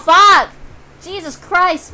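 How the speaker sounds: ordinary talk, nothing marked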